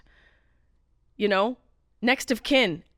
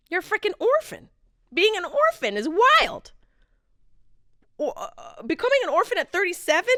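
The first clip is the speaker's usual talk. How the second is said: exasperated; 10 semitones higher than usual. Real, not acted. Repeats line with exasperation.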